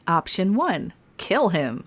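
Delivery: ordinary talk, nothing marked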